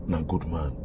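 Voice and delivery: "na good man" murmured low and soft; hushed tribute. Real, not acted. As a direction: drop to a low murmur of praise.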